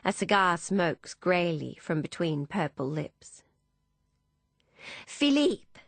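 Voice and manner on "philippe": high, triumphant cry. A narrator's reading. Emmeline greeting her lover at the door, triumphant. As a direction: greet your rescuer in triumph. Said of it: "says Emmeline triumphantly".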